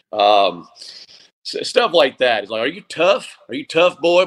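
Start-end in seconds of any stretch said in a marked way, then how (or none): 2.6-3.4 higher Southern coach voice rising on tough; a lightly imitated Southern coach testing a player; Southern coach challenge. Coach challenging a player in a light Southern accent. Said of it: "a very distinct Southern accent". 3.5-4.3 higher Southern coach voice falling firmly on tough; a lightly imitated Southern coach pressing the point; Southern coach challenge. Coach pressing a player in a light Southern accent. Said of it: "a very distinct Southern accent".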